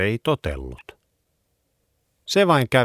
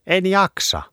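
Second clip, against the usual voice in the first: whiny; 12 semitones higher, 3 dB louder than usual. Acted. Dog whines refusal.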